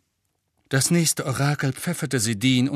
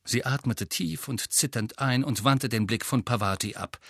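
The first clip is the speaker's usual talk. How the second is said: usual speaking voice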